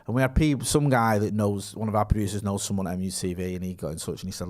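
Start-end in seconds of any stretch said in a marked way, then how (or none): none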